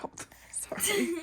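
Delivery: hushed whispered apology; quick soft sorry under your breath; real hushed sorry. Real, not acted. Apologizing quietly while recording.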